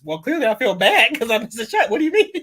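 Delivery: loud, high, laughing exasperation; exasperated mock answer. Imagined player to reporter, laughing and exasperated. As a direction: answer the obvious question through a laugh.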